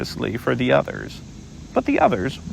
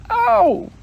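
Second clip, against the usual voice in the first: high, louder yelp of pain; squeaky ow; 5 dB louder than usual. Acted. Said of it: "he said".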